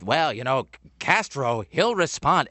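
mock bad Boston accent; hammed-up fake Boston vowels; Kevin Costner's Boston accent. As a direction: lay on a terrible Boston accent. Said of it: "Kevin Costner talking like this".

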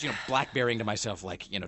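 ordinary talk

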